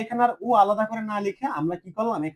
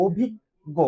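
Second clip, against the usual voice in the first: slow and deliberate, word by word; slow demo; much slower than usual. Shown as a demonstration. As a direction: spell it out slowly for the class. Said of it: "slow teaching demo". (ধীরে ধীরে উচ্চারণ)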